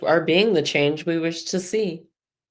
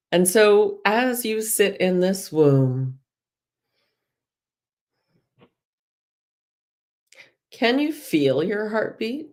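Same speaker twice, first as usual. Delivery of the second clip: guided meditation. Acted. Guide leading listeners through breath and release, soothing. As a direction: ease them gently into the womb visualisation.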